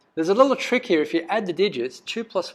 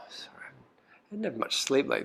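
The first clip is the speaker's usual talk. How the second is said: whispered aside; 2 semitones lower, 7 dB quieter than usual. Real, not acted. Apologetic aside to class.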